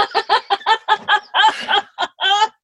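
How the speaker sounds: loud, high helpless laughing wail; shrieking laughter. Real, not acted. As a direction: let a long squealing laugh burst out. Breaks up after shrieked climax.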